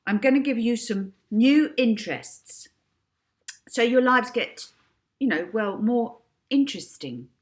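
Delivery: put-on girl hypnotist giving loud commands; hypnotic command. A narrator's reading. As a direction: lay down the new orders. Molly hypnotising.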